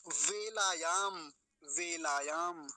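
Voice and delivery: वेलायाम said very slowly, starting with v, long vowels; clear v sound. Shown as a demonstration. Teacher modelling the next word, slow with clear v. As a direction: stretch वेलायाम as vay-laa-yaam. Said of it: "अब इसको बेला नहीं बनाएंगे".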